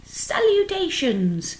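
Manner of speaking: high, drawn-out greeting; thin voice. A narrator's reading. Unseen creature greeting Wilbur, in a thin voice. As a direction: sing out the greeting high and slowly. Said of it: "he heard again the thin voice".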